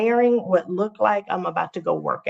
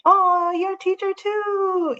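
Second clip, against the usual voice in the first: surprised; 14 semitones higher, slower than usual. Real, not acted. Surprised reply to viewer comment.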